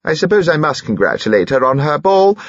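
high-voiced imperious old lady; eccentric dowager. Dowager complains about congratulating hostess, high and imperious. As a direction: declare it in a high, insistent voice.

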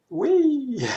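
high, loud playful wee; excited squeal. Real, not acted. Playing with ripple effect.

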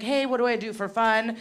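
ordinary talk

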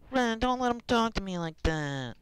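high whiny pleading voice. Retold or imitated. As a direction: whine like a helpless victim. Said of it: "the whiny voice".

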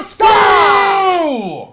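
shouting at the screen, high and loud; excited shout. Real, not acted. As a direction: yell it out as the lead slips away. Yelling at the game.

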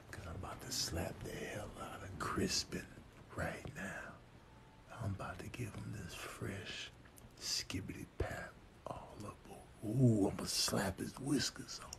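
hushed, scheming whisper; sneaky whisper. Acted. Plotting to slap sleeping Crispin, sneaky whisper. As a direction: whisper it so the sleeper won't wake.

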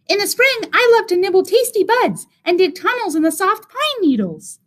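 high, happy little mouse; tiny mouse voice. A narrator's reading. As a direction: mouse sharing joys. Mouse character voice.

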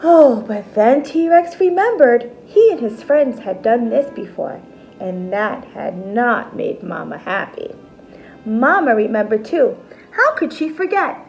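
ordinary delivery

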